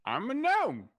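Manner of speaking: high, loud little gnome. Acted. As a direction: shout it proudly. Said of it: "my voice got very loud".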